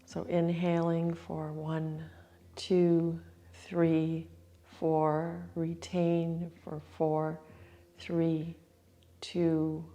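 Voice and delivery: soft, calm breath-count cues; guided breathing count. Acted. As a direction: count the breath slowly and gently. Counts breath retention rounds.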